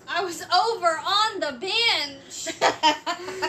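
playfully exaggerated, loud and slow; hammed-up comic delivery. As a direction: stretch the words out for a laugh. Jokey skit-like quote, then laughs.

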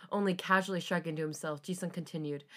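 ordinary delivery

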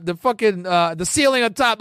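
ordinary talk

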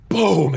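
loud, high "boom"; punchy vocal explosion effect; imitated explosion. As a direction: burst out with a mine blast.

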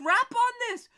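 high loud hype yell; excited shout. Retold or imitated. Excitedly quoting reply.

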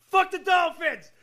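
loud, high mock yell, drawn out; retold outburst. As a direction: imitate an outraged fan yelling. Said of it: "He's like".

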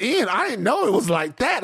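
high, mock-amazed gushing; mimicking co-host. Host teasing co-host about praising Ian, high mocking imitation. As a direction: parrot her praise in a high voice. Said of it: "you said".

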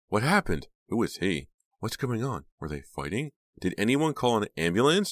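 high, loud screaming questions. A narrator's reading. Jade screaming questions over Chuck, screaming. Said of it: "Jay was screaming".

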